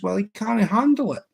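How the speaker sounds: squeaky voice pitched well up for the punchline; hammed-up high voice on the last line; joke punchline voice. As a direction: land the punchline in a high mock voice. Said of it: "He says".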